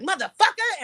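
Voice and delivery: high-pitched, indignant retold curse; retold cussing. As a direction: toss the curse out high, still heated. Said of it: "And I kept cussing".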